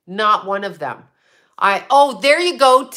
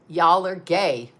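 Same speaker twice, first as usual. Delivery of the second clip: normal talking voice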